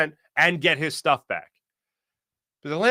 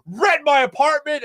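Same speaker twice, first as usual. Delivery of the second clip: loud, high outraged imitation; mock an incredulous scream; retold yelling; 10 semitones higher, 5 dB louder than usual. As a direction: fire it back as an indignant yell, pitched way up. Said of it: "screaming and yelling and screaming and yelling".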